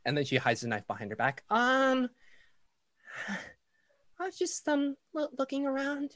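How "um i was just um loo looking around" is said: put-on high, timid, hesitant child; little girl voice. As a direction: stammer like a shy caught-out kid. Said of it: "a small girl".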